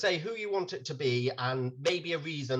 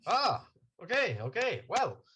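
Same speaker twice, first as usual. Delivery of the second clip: lightly done Irish lilt, a bit higher; fake Irish accent; 6 semitones higher than usual. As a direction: slip into a hint of an Irish barman. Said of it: "Why have I got an Irish accent now?".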